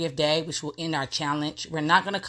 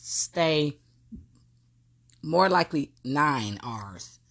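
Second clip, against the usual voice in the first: normal talking voice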